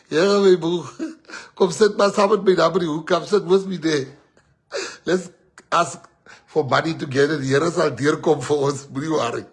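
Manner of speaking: hammed-up tramp inviting him to sit; imitating the tramp.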